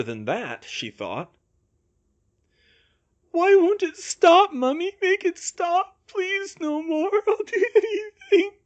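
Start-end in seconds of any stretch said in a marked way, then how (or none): 3.3-8.5 high, tearful begging; delirious. A narrator's reading. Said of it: "Bill sounded delirious".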